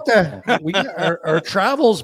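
words tumbling out through a laugh; laughing excitedly. Real, not acted. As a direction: laugh while you tell the good news. Excited laughing about All-Star trip.

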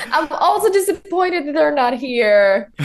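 laughing through the words; high giggly laugh-talk. Real, not acted. Laughing along with host.

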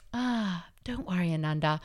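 soft, soothing reassurance; quietly calm a panicking friend; calm Buddha. Retold or imitated. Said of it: "the Buddha would be like".

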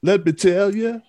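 high-pitched mock crooner line; hammed-up singer catchphrase. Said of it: "he keeps saying".

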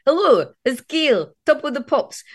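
playing a little girl pretending to present the show; childhood TV presenter. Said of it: "And then I would just go".

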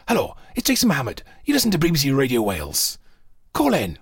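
radio broadcaster impression; clipped phone-in host, each phrase falling away; Jason Mohammed. Doing a niche radio presenter for co-host, broadcaster impression. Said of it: "Jason Mohammed speaks like this".

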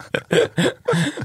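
laughs high and breathy; high burst of laughter. Real, not acted. Laughing at the story.

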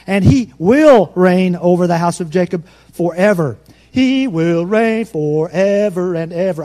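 high, loud, proclaiming Bible reading; loud scripture reading. Acted. Pastor reading Luke aloud to the church, loud proclamation. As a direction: declaim the verses to the back row.

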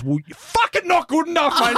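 high, put-on berating voice, half joking; mock the boss tearing into him; imitating angry boss. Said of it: "And he goes".

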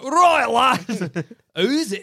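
high, hammed-up cockney lad; cartoon cockney British accent; mock cockney accent. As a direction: ham up a cockney tough guy. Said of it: "not even that cockney".

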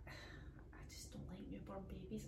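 breathy confessional whisper. Real, not acted. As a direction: whisper it as a guilty secret.

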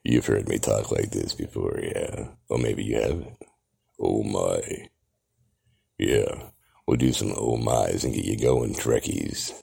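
deep, slow, low-pitched come-on voice; octave down low. Shown as a demonstration. Q dropping his voice low to tease the Trekkies, deep and slow. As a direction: drop the voice low and seductive for the fans. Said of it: "We'll drop that octave down low".

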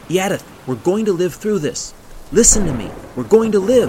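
high, forceful shouted rallying; urgent yelling. A narrator's reading. Gunhild rallying her sobbing friend in the storm, yelling. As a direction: yell hope into a terrified friend. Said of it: "she yelled".

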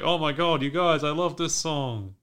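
imitated giddy enthusiasm; excited retelling. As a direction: gush mildly, acting out a fan. Acts out excited reaction to song.

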